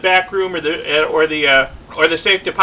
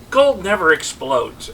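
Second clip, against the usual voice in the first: ordinary delivery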